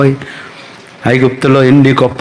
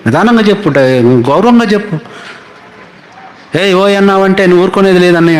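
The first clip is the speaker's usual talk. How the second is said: higher, quicker mock sheep backtalk; cheeky sheep; 5 semitones higher than usual. Sheep scolding Moses for hitting them, high cheeky voice. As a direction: talk back to the shepherd like an insolent sheep. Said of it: "అన్నయ్య అంట గొర్రెలు మోషేతో".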